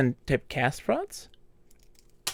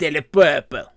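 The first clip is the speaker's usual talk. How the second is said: mock French accent, a touch louder; 4 dB louder than usual. Player joking in French about a purple cloud, mock French accent. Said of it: "He say".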